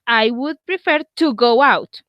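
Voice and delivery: slow, careful English example; slow model sentence. Shown as a demonstration. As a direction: spell it out slowly for students. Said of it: "teacher models the example slowly".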